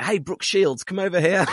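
a playful shout to Brooke Shields; calling out. Retold or imitated. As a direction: beckon her over, a bit cheeky. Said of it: "So we're like".

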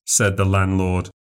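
ordinary talk, nothing marked

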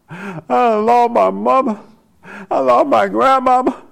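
high, drawn-out mock wail of grief; mock carrying on. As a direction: imitate mourners making a big show of loss.

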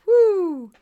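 loud, high, swooping "woo"; crazy whoop. Acted. Host imitating wild politicians, loud crazy whoop. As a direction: whoop like someone totally unhinged. Acting out how wild they are.

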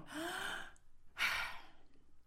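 quick high gasping breath in; sharp gasping inhale. Shown as a demonstration. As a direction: demo a big gasp in. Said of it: "We go".

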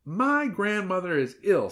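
put-on high, slow girl's voice; earnest girl character. A narrator's reading. Girl tells the wolf of her sick grandmother, earnestly.

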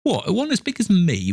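put-on very high, boyish incredulity; high-pitched, disbelieving child; amazed boy. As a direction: squeak it out as an astonished lad. Boy amazed at Scrooge's question.

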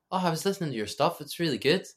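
quick, high-pitched mock gushing; retold eager compliment. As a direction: imitate an enthusiastic manager, high and loud. Manager's compliment acted out in retold story.

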